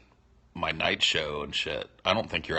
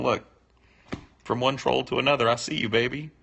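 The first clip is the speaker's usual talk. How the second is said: 1 knowing nod; 7 semitones higher, 4 dB louder, faster than usual. Retold or imitated. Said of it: "me tipping my hat, going".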